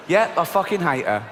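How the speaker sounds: normal talking voice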